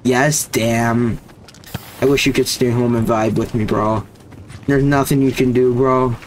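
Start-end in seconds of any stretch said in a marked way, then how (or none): none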